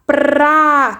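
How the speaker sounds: PRA with P, R and A all sounded, drawn out; slow, stretched PRA for learners; sounding out blend. Shown as a demonstration. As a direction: roll slowly through the R in PRA. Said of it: "tenho que falar o som do P".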